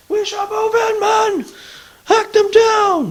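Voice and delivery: high-pitched drawn-out commander's yell; rally the squad with a high war cry; shouted battle order. Acted. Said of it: "shouting to his squad".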